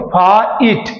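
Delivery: फाइट split into faa and eet; double vowel shown. Shown as a demonstration. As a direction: break फाइट between its two vowels. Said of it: "साथ में ई का sound". (फाइट को फा, ईट में तोड़कर)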